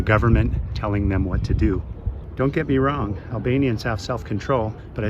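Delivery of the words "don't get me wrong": normal talking voice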